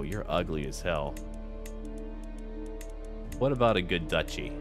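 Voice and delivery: normal talking voice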